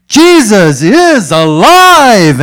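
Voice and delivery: huge, high, very slow shout; preacher roaring to a whole mountain; all-out yell. Retold or imitated. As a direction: stretch each word into a massive proclamation. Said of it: "And he's like".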